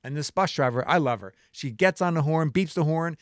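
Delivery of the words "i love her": ordinary talk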